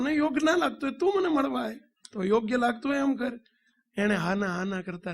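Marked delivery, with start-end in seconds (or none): 0.0-3.4 much higher and fast, a quick challenge; heated comeback. Retold or imitated. The swami daring the boy to come and meet him, heated and fast. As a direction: fire the choice straight back at him. Swami counters boy's excuse.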